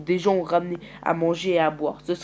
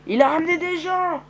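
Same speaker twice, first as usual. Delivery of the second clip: excited announcement; 11 semitones higher, 5 dB louder than usual. Acted. Kenny announces arrivals.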